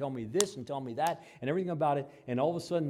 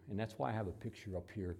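normal talking voice

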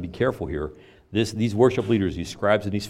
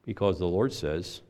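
usual speaking voice

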